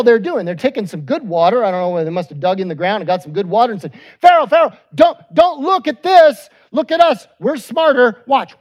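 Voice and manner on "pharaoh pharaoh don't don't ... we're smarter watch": loud, high showy shout to Pharaoh; mock shouting magicians. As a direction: call out like a boastful court magician.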